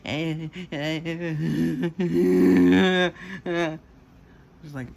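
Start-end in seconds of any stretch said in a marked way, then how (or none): 0.0-3.8 high, loud drawn-out mock wail; hammed-up crying bleats; imitated crying. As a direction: build sobs into a long put-on wail.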